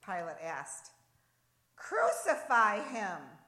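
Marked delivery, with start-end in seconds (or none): 1.8-3.4 loud, drawn-out shout; slow, forceful chant-like yell; crowd's shout. A narrator's reading. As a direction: cry it out as an angry mob would. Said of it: "came the response".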